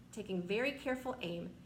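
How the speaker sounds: plain reading voice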